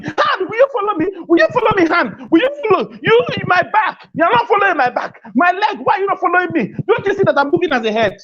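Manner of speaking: high, fast nagging scold of limbs; head scolding the body. Pretend. Head pleading with the hand and leg, high nagging voice. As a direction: whine at your hand like a fed-up boss.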